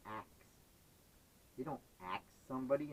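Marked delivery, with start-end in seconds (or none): 0.1-0.3 "Axe" with K and S swapped; nonstandard "ask". Retold or imitated. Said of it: "they say".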